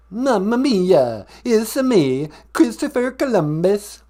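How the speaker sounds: high, loud hammed-up Italian; bad Italian accent. Columbus phoning a podcast, badly faked Italian accent. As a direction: ham up a fake Italian accent. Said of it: "Italian accent at all".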